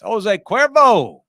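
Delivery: high, loud, drawn-out announcer holler; big showy slow shout; hammed-up brand shout. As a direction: belt out the name with long vowels.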